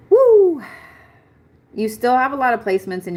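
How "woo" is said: loud, high-pitched woo; sudden loud "woo"; high whoop. Real, not acted. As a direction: let out a big high whoop. Reacting to the chart.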